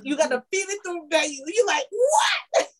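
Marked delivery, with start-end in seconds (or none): 1.9-2.7 very high squeaky what; startled old man. Retold or imitated. Friend acts the fed old man startled, a high squeak. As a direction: squeak it out like a confused elder.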